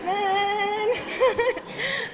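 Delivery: high laugh after cheering. Real, not acted. Speaker laughing after her school cheer, high.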